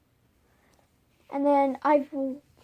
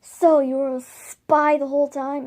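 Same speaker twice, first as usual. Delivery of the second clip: a louder, accusing question; 9 dB louder than usual. Acted. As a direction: call her out as a traitor, loudly. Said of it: "Hawk Frost would be like".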